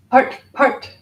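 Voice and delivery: loud, high, explosive "Part, part"; lightning burst. Shown as a demonstration. Teacher making the sound that cuts through delusion, sudden loud "Part, part". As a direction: fire each "part" like a flash of lightning. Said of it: "it's like a flash of lightning".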